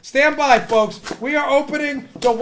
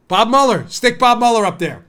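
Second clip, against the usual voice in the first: normal talking voice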